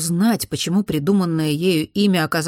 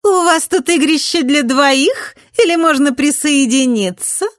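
high, loud, teasing; snide girl voice; 10 semitones higher, 6 dB louder than usual. A narrator's reading. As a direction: taunt them, high and sly. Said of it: "ехидный женский голос".

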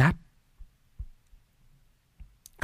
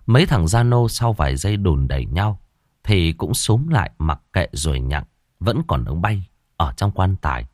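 plain reading voice

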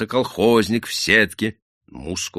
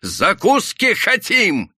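high, slow drawn-out yell; орут; 4 dB louder, much slower than usual. A narrator's reading. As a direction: yell it, stretching the words. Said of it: "заорал губастый парень и заросший мужик". (протяжный ор толпы)

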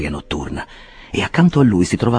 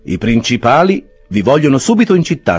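Jackson the clerk; 7 semitones higher, 5 dB louder than usual. A narrator's reading. Clerk summoning Mrs Bardell.

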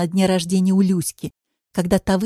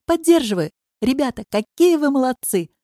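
high-pitched, enthusiastic praise; gushing approval; delighted cheering; 6 semitones higher, 3 dB louder than usual. A narrator's reading. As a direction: cheer them on in a high, excited voice. Аллочка applauds the plan with delight.

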